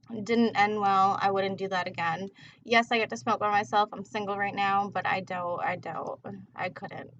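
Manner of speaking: ordinary delivery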